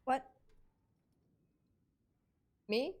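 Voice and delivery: normal talking voice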